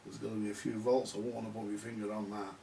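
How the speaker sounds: usual speaking voice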